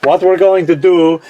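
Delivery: hammed-up Russian accent; mock-Russian science show host. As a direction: explain the experiment in a put-on Russian accent. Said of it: "the best of my Russian accent".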